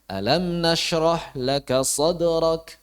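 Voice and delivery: "Alam nashrah laka sadrak" recited slowly, SADRAK held up as a question; slow, firm chant that keeps "sadrak" lifted and asking; firm questioning recitation. Shown as a demonstration. Teacher shows students the fitting reading, "sadrak" firmly questioning. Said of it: "Nah, baru, oh iya, ada unsur tanyanya tuh tegas".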